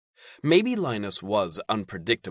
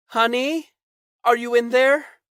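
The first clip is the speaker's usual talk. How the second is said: high, loud sweet mother's call; mother calling through the door; 8 dB louder than usual. Acted. Mother calling to her son through the door, high and sugary. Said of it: "his mother called through".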